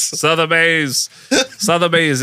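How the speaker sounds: Sotheby's stretched slow and high, SUH-ther-bayz in broad American; owning his American accent, drawn out; exaggerated American accent. Shown as a demonstration. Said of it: "It's my American accent".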